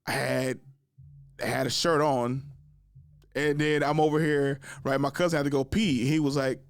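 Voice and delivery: usual speaking voice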